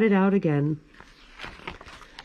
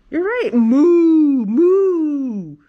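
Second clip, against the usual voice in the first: loud high drawn-out moo; mooing cow; 7 semitones higher, 7 dB louder, much slower than usual. Acted. Cow asking the librarian, mooing loudly and slowly.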